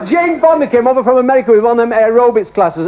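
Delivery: usual speaking voice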